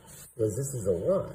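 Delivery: normal talking voice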